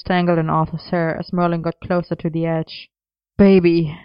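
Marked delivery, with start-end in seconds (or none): 3.4-4.0 word pushed out louder on a gasp; choked gasp. A narrator's reading. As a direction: choke it out, short of breath. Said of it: "choked around quiet gasps".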